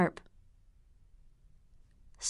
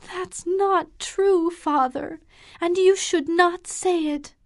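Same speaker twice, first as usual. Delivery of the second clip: high, louder protest; crying out; 9 semitones higher, 3 dB louder than usual. Acted. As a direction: cry out against his words. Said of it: "she cried".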